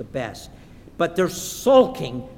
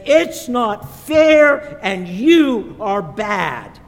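put-on very loud, slow, stretched-out child's protest; high, emphatic mock tantrum; pouting child's tantrum; 5 semitones higher, 10 dB louder, much slower than usual. Olivia accuses her mother, acted pouting yell. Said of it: "she begins to pout".

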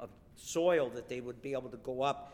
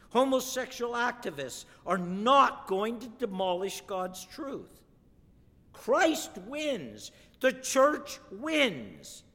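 louder, higher, rising to a triumphant cry; fiery preaching; 5 semitones higher, 6 dB louder than usual. Real, not acted. Preacher rousing the congregation, triumphant and loud. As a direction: proclaim it like a battle won.

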